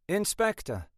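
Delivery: high, cut-glass English greeting; cut-glass accent. A narrator's reading. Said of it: "with a cut glass accent".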